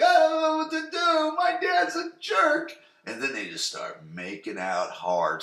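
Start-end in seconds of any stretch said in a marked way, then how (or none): 0.0-2.7 very high, hammed-up sobbing tough guy; mock whiny Dylan. Dylan hugging Brenda about his dad, very high mock whine. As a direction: whine it like a wounded bad boy.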